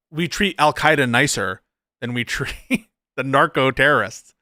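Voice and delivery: normal talking voice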